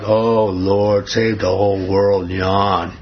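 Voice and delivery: low, bored mock praying; mock half-hearted prayer. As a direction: drone it like a prayer nobody means. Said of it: "It's not like".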